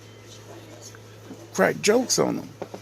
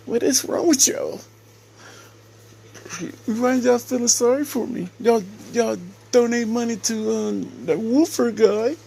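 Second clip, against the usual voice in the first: mocking whiny plea; 9 semitones higher than usual. Mocking someone who begs viewers for sympathy, high whiny pleading. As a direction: ham up a pitiful sob-story plea. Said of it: "You wanted sympathy, like".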